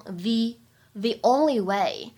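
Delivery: "the" said "thee" before "only", slow; the before a vowel. Shown as a demonstration. Teacher showing "thee" before "only", slow. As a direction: read "the only way" with "thee", slowly. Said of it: "定冠词需要重读".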